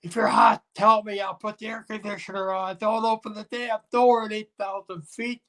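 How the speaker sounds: high, mock-exasperated scolding; a retold pilot lecturing a man who opened the door because he was hot.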